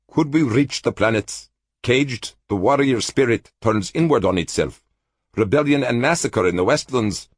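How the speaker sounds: lightly voiced troubled prophet; a hint of foreboding; Cultan, brooding. A narrator's reading.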